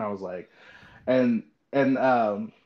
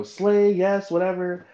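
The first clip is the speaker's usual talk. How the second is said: higher, hyped-up praise; cheering aunt; 7 semitones higher, 3 dB louder, slower than usual. Retold or imitated. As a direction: imitate an aunt's fan cheers. Retelling aunt's cheering comments.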